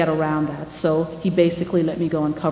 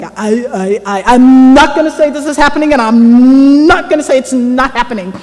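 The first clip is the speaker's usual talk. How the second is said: louder, halting evasive non-answer; stammering official; 5 semitones higher, 9 dB louder than usual. Retold or imitated. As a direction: stumble over the I, I and dodge. Police chief stammering non-answer.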